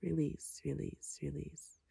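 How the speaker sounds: fading release. Acted.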